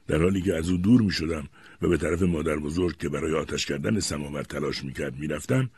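usual speaking voice

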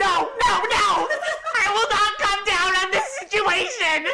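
high, loud no; indignant shout. Real, not acted. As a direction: shout the refusal. Refusing to calm down.

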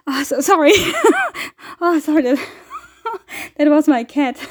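flustered apology broken by laughs; real high nervous giggling after a fright; startled laughter. Real, not acted. Apologizing for the cat, laughing.

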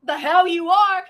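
loud, slow angry retort. Retold or imitated. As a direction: shut her down hard. Bo angrily refusing the girl.

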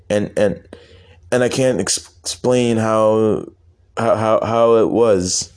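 halting, tongue-tied stutter, stretching words out. Acted. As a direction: stammer and restart on the words. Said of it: "stop your stuttering".

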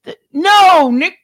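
high, loud shocked protest; shocked outcry. Real, not acted. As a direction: cry out in shocked disbelief. Shocked outrage.

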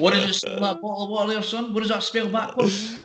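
high, quick mock old-guy voice; imitating a man seizing his big moment; psyched-up old customer. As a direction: rattle off the rehearsed joke eagerly. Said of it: "A guy's psyching himself up".

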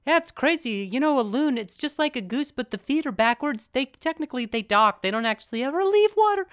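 high, strained-casual chatter; nervous falsetto-like small talk; different register. Retold or imitated. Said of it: "my voice is in a different register".

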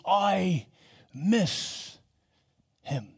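a mild, teary voice breaking; tearful. Retold or imitated. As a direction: say it as if fighting back tears. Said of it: "wept and sobbed".

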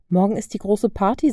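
plain reading voice

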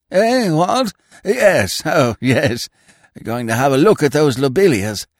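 put-on high, fluttery voice of a vague old lord; a dithering aristocrat caught off guard, pitched high; flustered old earl. Vague old earl startled by his secretary, high and flustered. Lord Emsworth roused from thought.

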